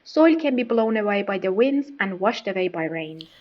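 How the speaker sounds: ordinary delivery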